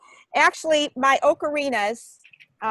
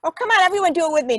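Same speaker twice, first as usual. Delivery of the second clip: excited call to join; 6 semitones higher, 4 dB louder, much faster than usual. Real, not acted. Rallying the audience to join in.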